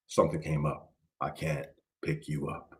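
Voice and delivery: a dad letting his son down quietly on the phone; father cancelling. Retold or imitated. Father cancels third Friday.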